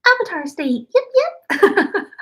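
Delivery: mock the actress's high squeal on yip yip; high-pitched imitation. Mocking the Aang actress's voice, high-pitched squeal. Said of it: "it's, like, all high pitched".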